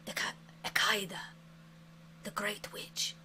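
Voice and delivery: breathy whispered warning; ominous whisper. Acted. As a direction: hush the name like a forbidden secret. GM whispers the witch's name.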